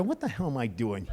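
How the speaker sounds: low, quiet muttering to oneself; soft, deflated aside; chastened self-reproach. Retold or imitated. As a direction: drop the voice, suddenly ashamed. Retold self-reproach walking to car.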